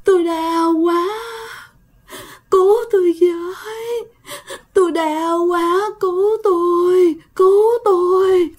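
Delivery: high, slow, drawn-out moans of pain; ghostly moaning. A narrator's reading. Ghost moaning in the night for help, high and drawn out. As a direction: moan like a ghost begging for help. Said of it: "dạng giống như là rên".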